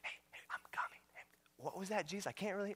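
hushed, breathy-free mock whisper of a sneaking Jesus. Preacher mocks a secret coming to the congregation, whispering. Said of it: "Jesus is gonna whisper in".

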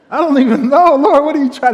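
hammed-up exasperated prayer; exasperated plea. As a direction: plead upward in mock dismay. Acted exasperated plea to the Lord.

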